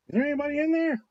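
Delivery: high, sing-song check on a dazed person; retold calling out. Retold parent checking on glazed-over child, calling out.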